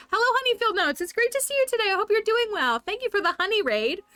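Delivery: pitched well up, eager hostess greeting; bright raid welcome. Real, not acted. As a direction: welcome them like guests at your door.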